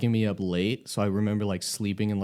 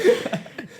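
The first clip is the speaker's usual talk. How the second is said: high laugh; 4 dB louder than usual. Real, not acted. Teller bursts out laughing.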